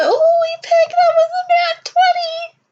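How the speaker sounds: very high, giddy exclamation; excited amazement. Real, not acted. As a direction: marvel loudly at the lucky roll. Celebrating the lucky roll.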